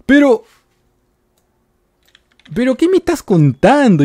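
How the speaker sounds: high, loud wail of protest; exasperated outcry. Real, not acted. Player caught again protests loudly, exasperated outcry.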